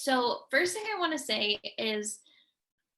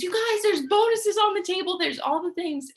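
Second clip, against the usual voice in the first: high, bright-eyed hype about the bonuses; excited leader voicing the group chat; hyped announcement; 9 semitones higher, 6 dB louder than usual. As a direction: sell the bonuses like big news.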